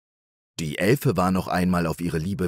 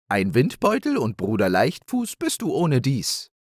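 high, modestly proud hare voice; proud hare Nepomuk; 7 semitones higher than usual. Acted. Hare telling his wife about his play, proudly.

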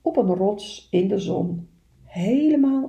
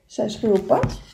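ordinary delivery, nothing marked

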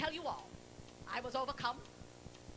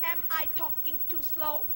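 mock slow delivery. Mock slow asking audience.

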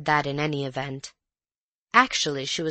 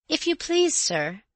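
respectful maid; 11 semitones higher than usual. A narrator's reading. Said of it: "she said in a low, respectful voice".

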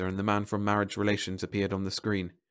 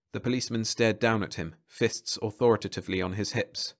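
plain reading voice

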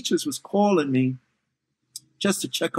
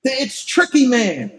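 excited mother; 5 semitones higher, 5 dB louder, slower than usual. Retold or imitated. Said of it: "she just turns to him".

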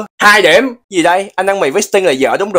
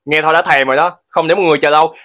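ordinary talk

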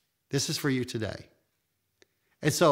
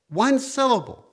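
high, loud exclamation of wonder; excited emphasis; 12 semitones higher, 8 dB louder than usual. Acted. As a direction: cry it out with joy. Repeating the gift of a syllable.

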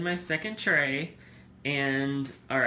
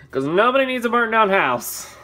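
emphatic; 7 semitones higher, 10 dB louder than usual. Acted. Emphatic warning.

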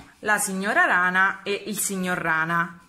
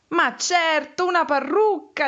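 high, loud eureka moment; excited realization; 8 semitones higher, 4 dB louder than usual. Acted. Mrs. Frog guessing the lost item is a wig, excited. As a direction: announce the answer in triumph. Frog thinks she solved it.

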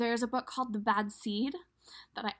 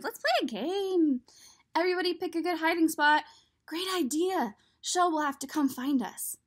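higher, bouncy playful voices; giddy eggs; 6 semitones higher, 4 dB louder than usual. Acted. The eggs planning hide and seek, higher and giddy. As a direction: sound like kids plotting a game.

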